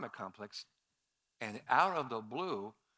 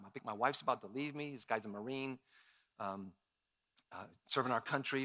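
ordinary talk

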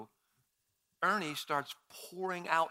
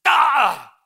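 loud high wordless yell of frustration; frustrated yell; 10 semitones higher, 18 dB louder than usual. Retold or imitated. Speaker angry at himself for chickening out, yelling. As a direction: let out an exasperated shout. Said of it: "I just was like".

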